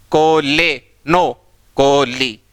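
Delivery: कोले drawn out with e, then कोली with ee; kole versus koli. Shown as a demonstration. Teacher contrasting syllables for the class, slowly. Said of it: "तीनों में ली हो रहा है".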